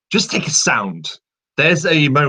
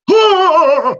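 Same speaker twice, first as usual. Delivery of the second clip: very high, loud, sharp yell; wizard's attack shout; Gandalf duel cry; 8 dB louder than usual. Retold or imitated. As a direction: strike with the staff and let out a short battle cry. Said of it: "that is the wizard duel noise".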